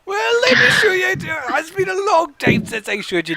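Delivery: put-on an excited old woodworker; eager old man.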